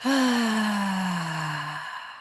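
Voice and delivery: slow deep breath out; long audible exhale. Real, not acted. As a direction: let out a long audible sigh. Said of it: "take a deep breath in".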